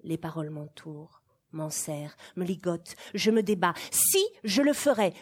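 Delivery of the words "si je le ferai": higher, louder burst of defiance; defiant shout. Acted. Child defying the governess, loud high shout. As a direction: fling the refusal back at her.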